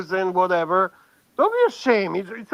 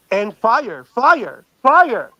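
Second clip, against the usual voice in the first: building hype; 6 dB louder, much slower than usual. Real, not acted. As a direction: hype it up, each repeat bigger. Hypes up the man described.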